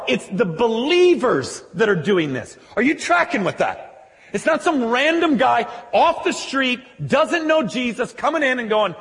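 loud, high, driving sermon voice; full-force preaching. Acted. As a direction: preach it out to the whole room.

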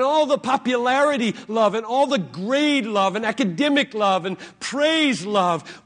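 loud, intense pleading prayer; fervent prayer. Acted. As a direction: pour it out to God. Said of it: "Pray like he prayed".

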